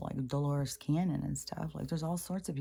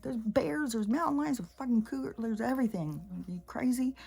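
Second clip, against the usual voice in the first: much higher, listing in mock disbelief; incredulous retort; 9 semitones higher than usual. Firing back at the realtor about wild animals, mock incredulous. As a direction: pile on the disbelief, climbing high.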